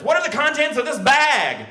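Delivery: high demanding cop voice; interrogating cop. Retold or imitated. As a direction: grill him like a cop.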